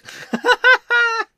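loud, high burst of laughter; real belly laugh. Real, not acted. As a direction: crack up out loud. Player cracks up.

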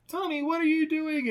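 high mock teacher; a lightly hammed teacher's alarm. Recapping, the teacher confronting Tommy.

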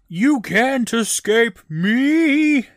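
high, loud yell with long-held last word; mock villain yell. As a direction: holler it slowly, hammed-up villain. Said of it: "The guy yells".

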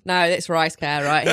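comic mock Australian accent. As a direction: lay on a put-on Australian accent. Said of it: "you're doing an Australian accent".

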